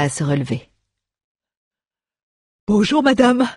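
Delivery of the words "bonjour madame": high character-voice hello; dwarf's polite greeting. A narrator's reading. Dwarf dusting himself off greets the lady, high simple hello.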